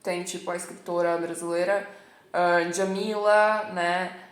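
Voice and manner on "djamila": "Djamila" slow and loud with a hard dj; slow emphatic "Djamila"; dj pronunciation. Shown as a demonstration. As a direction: stretch "Djamila" with a clear dj. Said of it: "eu já vi pessoas chamando assim".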